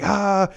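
high charging yell; battle cry yell. Retold or imitated. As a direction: yell as you swing. Charging Thanos again.

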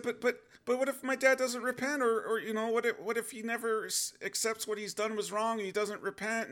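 high, stammering worried questions; plead, tripping over words; anxious pleading. Retold or imitated.

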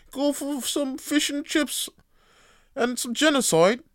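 put-on cheerful British accent for a dark joke; mock British accent. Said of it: "'cause they have a British accent".

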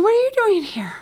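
very high-pitched imitated question; re-enacted surprise; surprised grandmother.

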